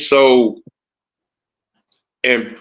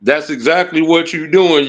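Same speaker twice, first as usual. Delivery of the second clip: ordinary talk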